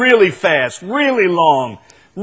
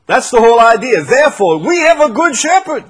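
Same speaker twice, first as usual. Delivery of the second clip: full preaching voice; 7 semitones higher, 6 dB louder than usual. Acted.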